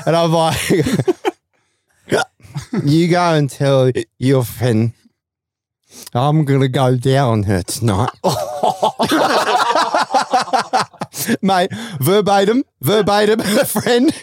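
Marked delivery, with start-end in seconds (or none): 2.8-8.1 put-on drunk slur. Drunk pickup line retold. 8.1-11.4 long, high wheezing laugh; long high laughter. Real, not acted. Mates hear the punchline, long high laughter.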